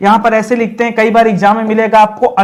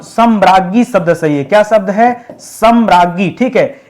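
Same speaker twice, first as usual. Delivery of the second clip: normal talking voice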